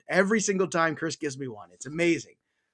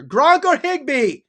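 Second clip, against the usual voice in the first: mock shouted, high and slow; all-caps yell; 9 semitones higher, 6 dB louder, slower than usual. Shown as a demonstration. Host acting out a viewer's all-caps question, mock yelling. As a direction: belt it out like the caps read. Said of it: "he's using all capital letters here".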